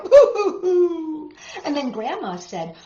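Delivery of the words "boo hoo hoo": loud high laughing boo-hoo; playful pretend wail; mock crying. Reading to kids, the little boy's hammed-up crying. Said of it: "the little boy cried".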